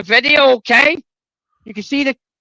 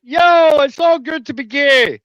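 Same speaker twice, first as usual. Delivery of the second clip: exaggerated jeering caricature, pitched way up; mock Edinburgh locals; 6 semitones higher than usual. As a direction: sing it out high as a hammed-up impression. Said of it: "where they're like".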